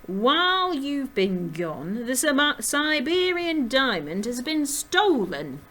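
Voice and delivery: loud, high, drawn-out character voice; slow, heavily stressed and worked up; agitated American jackrabbit. A narrator's reading. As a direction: act out an agitated rabbit breaking bad news. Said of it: "slapping her whip on her leather boots in agitation".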